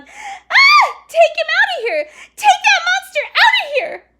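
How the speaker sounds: put-on very high, loud shrieking girl; panicked yell. A narrator's reading. Girl on a chair seeing the dog, panicked yell. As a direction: scream it in full terror. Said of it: "jumped up on the chair and yelled".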